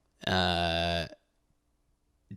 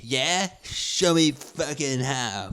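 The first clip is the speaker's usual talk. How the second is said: higher, slow, nerdy dare; nerdy kid comeback; 6 semitones higher, much slower than usual. Retold or imitated. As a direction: squeak back a slow dare. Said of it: "younger kid getting picked on".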